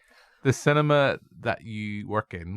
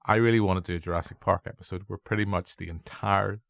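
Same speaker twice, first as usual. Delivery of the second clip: ordinary talk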